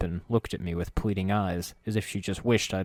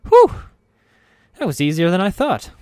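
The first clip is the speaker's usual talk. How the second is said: winded, relieved exhale; tired relief; 10 semitones higher, 10 dB louder than usual. Acted. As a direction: puff it out tired. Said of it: "sighed tiredly".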